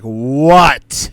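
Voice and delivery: loud, sharp mock outrage; shocked what. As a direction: bark the what in loud disbelief. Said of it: "And she's like".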